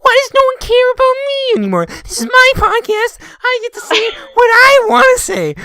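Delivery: high, loud, bossy rant; domineering. Acted. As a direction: shout them down like an overbearing wife. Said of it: "you sound like an overbearing wife".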